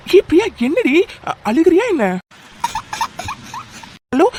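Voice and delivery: high, urgent, worried questioning; alarmed concern. Acted. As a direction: react in alarm to her tears. Alarmed at hearing her crying. (பதற்றமான அக்கறையுடன்)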